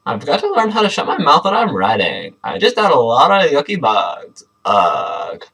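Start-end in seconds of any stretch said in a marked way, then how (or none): none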